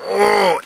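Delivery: long toilet grunt; imitated straining grunt. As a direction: mimic a long, straining groan. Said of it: "we hear a grunt, like this".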